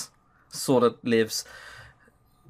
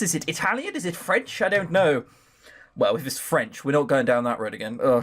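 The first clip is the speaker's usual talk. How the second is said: normal talking voice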